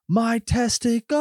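high, slow imitated anguished yell; mock Cage screaming in pain, drawn out; Nicolas Cage yell. Film fan imitating Nicolas Cage's pained yell for his co-host, high and drawn out. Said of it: "and yell".

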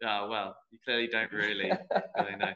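soft, amused put-down with a laugh; laughing retort. Retold or imitated. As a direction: chuckle through the dig. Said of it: "And I went".

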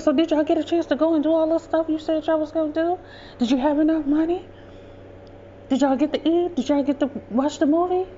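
high-pitched string of eager questions; eager questioning mother. Retold or imitated. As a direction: imitate a mother wanting every detail. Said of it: "She wanted to hear all".

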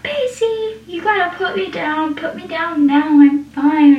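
imitating a panicked plea to be put down; mock freaking out. Joey slung over Pacey's shoulder, mock panicked pleading. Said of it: "basically freaking out".

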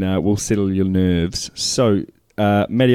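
ordinary talk

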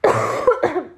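dry cough. Real, not acted. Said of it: "my throat is so dry".

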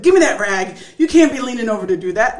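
loud mock order to hand it over; imitating fussing husband. Wife mimics husband grabbing the rag, mock scolding.

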